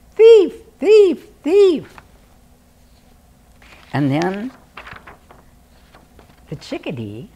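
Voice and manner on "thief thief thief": very high, loud bird cry; bird yelling. Acted. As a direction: screech it out like an angry jay.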